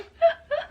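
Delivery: high squeal of a laugh; high squeaky laugh. Real, not acted. Laughs after retort.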